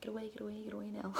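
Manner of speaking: very soft, under the breath; hushed shooing. Retold or imitated. Woman shooing the dog from her babies, hushed retelling.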